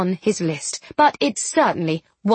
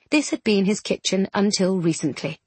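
ordinary delivery, nothing marked

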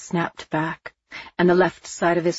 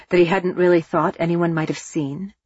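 ordinary delivery, nothing marked

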